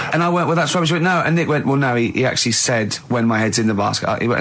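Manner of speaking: normal talking voice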